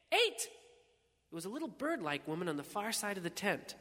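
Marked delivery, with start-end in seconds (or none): 0.1-0.5 very high, piping cry; called-out bid. A narrator's reading. Bird-like woman across the tent, high piping bid. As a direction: pipe up with a rival bid.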